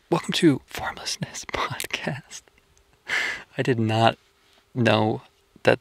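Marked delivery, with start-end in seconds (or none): 0.1-2.4 whisper. Pretend. Podcast welcome whispered.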